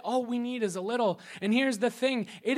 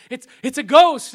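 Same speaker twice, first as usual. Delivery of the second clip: loud alarmed shout of a ghost; frightened cry; 3 semitones higher, 16 dB louder than usual. Retold or imitated. Disciples on the boat spotting a figure, frightened yell. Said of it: "They go".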